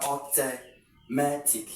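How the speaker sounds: "automatic" slowly drawn out from a long o; syllable by syllable. Shown as a demonstration. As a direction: break "automatic" into slow syllables. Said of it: "just long o sound".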